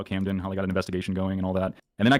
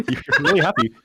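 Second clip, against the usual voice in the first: laughing; 7 semitones higher, 6 dB louder than usual. Retold or imitated. Laughing at friend's greeting.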